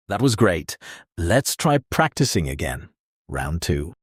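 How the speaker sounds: normal talking voice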